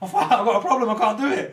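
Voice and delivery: loud, high wail through laughter; hammed-up helpless protest; mock despair. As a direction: wail it loudly, half laughing. Acts out an addict's dismayed complaint.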